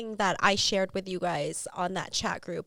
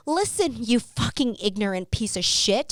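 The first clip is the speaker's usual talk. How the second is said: retold angry comeback; 5 semitones higher, 6 dB louder than usual. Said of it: "I turned around and I said".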